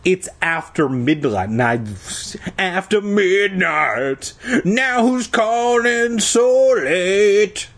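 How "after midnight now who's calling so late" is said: high, very slow hammed-up yawn. A narrator's reading. Dad scolding his kid past midnight, hammed-up yawning. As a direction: stretch the words through a big mock yawn. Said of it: "He yawned".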